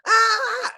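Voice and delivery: sudden high scream; a short high shriek, like a kid waking up scared; retold child scream. Dad imitating his daughter screaming at dawn, high shriek. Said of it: "like, screaming from her bed".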